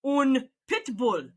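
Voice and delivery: put-on slow, deliberate Dutch-accented Un pitbull, falling; stern Dutch policeman repeating himself. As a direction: repeat it firmly like a Dutch cop. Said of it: "He says it again".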